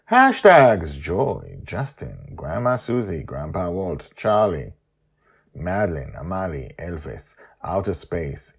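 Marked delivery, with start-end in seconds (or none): none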